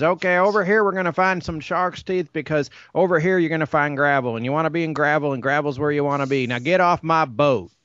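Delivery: high, fast-rolling Texan mumble; Boomhauer impression. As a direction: ramble it like Boomhauer on his boat. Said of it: "He talks like Boomhauer".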